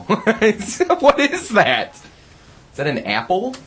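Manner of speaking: slow, high, laughing; laughing disgust. Real, not acted. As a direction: squeal through laughter at it. Finds odd apple, laughing.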